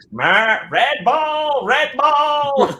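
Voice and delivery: high, strained chant of the vision; loud high precog warning; Minority Report precog. Retold or imitated. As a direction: call out the vision like a precog in a trance. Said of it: "I'm like one of the three people floating in the water".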